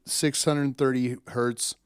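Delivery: usual speaking voice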